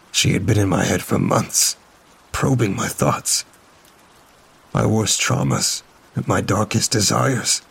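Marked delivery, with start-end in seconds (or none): none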